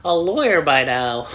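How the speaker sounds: mock condescending critic. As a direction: look down your nose at a grocery clerk. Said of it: "You're gonna be like".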